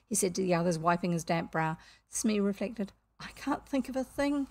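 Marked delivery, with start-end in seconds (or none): 3.2-4.5 higher, mildly regretful sailor. A narrator's reading. Bosun has no question for captain, regretful. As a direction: admit defeat with regret. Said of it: "he said regretfully".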